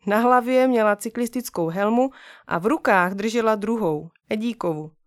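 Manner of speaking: plain reading voice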